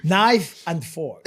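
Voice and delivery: slow correction. Retold or imitated. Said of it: "I was like".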